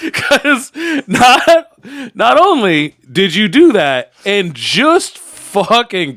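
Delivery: loud, high laughing words; cracking up mid-sentence. Real, not acted. Laughing hard.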